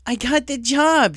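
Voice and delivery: loud, high and drawn out with joy; joyous near-scream. Acted. Rainbow to her friend at the door, joyous shout. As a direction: burst it out in delight. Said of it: "she nearly screamed joyously".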